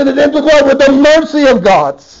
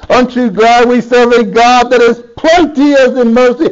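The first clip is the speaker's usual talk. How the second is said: normal talking voice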